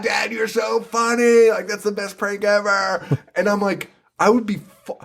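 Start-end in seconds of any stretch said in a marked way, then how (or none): 0.0-3.0 mock gushing son. Son praising dad's prank, high gushing voice. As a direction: ham up the adoring son.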